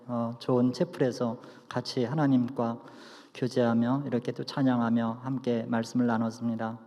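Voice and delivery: hushed, low benediction prayer. Acted.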